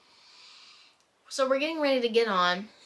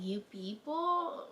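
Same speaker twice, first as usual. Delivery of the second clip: incredulous; 6 dB quieter, much slower than usual. Retold or imitated. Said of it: "And I was like".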